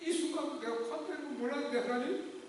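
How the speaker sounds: softly, on the edge of tears; a hint of tears while retelling; choked up. Real, not acted. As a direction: let the grief catch in the throat. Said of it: "막 울면서".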